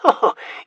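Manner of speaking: a short, high, breathy laugh; a quick, delighted laugh. Acted. Vincent laughs at the bickering siblings. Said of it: "Vincent laughed".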